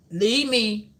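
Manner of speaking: put-on a toddler shoving you off; hammed-up toddler yelling leave me alone; mock toddler. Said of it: "that's what the two-year-old said".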